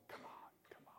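God's gentle whisper urging us on; a whispered come on from God; whispered coaxing. Retold or imitated.

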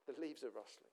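a soft second echo of "The leaves are rustling". Shown as a demonstration. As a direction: let "The leaves are rustling" fall away, hushed. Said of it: "repeated softer".

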